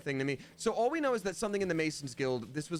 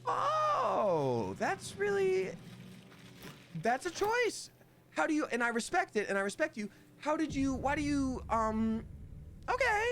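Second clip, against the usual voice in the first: much higher mock shocked voice, stammering politely; appalled stranger; 12 semitones higher, slower than usual. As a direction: play someone horrified but trying to stay polite. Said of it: "and they go".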